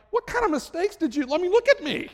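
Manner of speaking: mock the idea that anything went wrong; cocky disbelief. Son boasting to his mother, cocky and incredulous. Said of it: "and I'm like".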